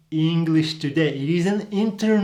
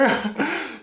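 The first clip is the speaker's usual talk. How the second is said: short laugh; 9 semitones higher than usual. Real, not acted. Laughs at own joke.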